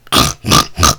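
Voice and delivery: loud, low snorting; imitated piggy snorts. Baby snorts at Alice, loud imitated snorting. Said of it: "snorts at her".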